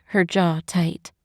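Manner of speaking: usual speaking voice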